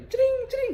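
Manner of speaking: high, quick "cering" sound effect; imitated zinging jolt. Patient describing therapy on the belly, high imitated zings. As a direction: voice the sharp zaps as ringing noises. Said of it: "Kemudian perut saya di".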